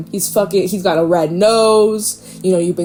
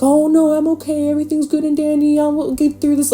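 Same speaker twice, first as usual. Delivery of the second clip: high, breezy fake-okay voice; mock chipper denial; fake cheerful; 8 semitones higher, faster than usual. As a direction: pretend everything's fine. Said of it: "Be like".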